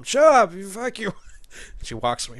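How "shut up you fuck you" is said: hammed-up shout, higher and louder; mock angry yell. Gamer mocking a breakup movie, hammed-up yell. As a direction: yell it like a cheesy movie fight. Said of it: "It's like".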